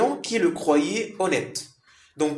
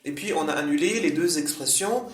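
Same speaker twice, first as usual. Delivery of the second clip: ordinary delivery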